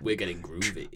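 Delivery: low and soft, sultry. Pretend. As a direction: purr it like a smooth answerphone. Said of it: "answering machine messages".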